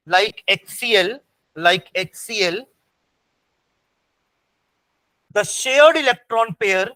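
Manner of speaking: very slow, phrases repeated for note-takers; teacher's drawn-out dictation voice. Real, not acted. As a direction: dictate word by word, leaving gaps. Dictating notes to students.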